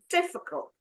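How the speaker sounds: "Difficult" with DIF pitched high, then falling; teacher's demo of "Difficult", stressed on DIF; stress on first syllable. Shown as a demonstration. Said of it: "The first syllable".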